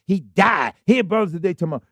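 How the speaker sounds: ordinary talk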